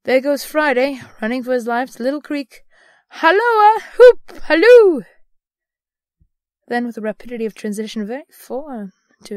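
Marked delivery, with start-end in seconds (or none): 0.0-2.6 loud, eager pointing out; excited commentary. A narrator's reading. Scrooge watching Friday run, excitedly. 3.1-5.0 very high, loud whooping; excited hallooing. A narrator's reading. Scrooge hallooing after Friday, whooping loudly.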